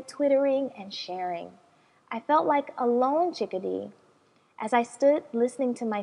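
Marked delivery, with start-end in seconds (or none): none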